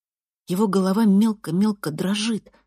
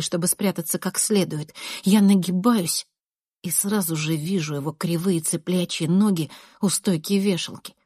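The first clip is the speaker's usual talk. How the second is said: usual speaking voice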